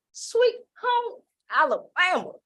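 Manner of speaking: a playful touch of Alabama accent; Southern accent. Shown as a demonstration. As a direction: lean into the Alabama accent, drawn out. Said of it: "Y'all heard that accent".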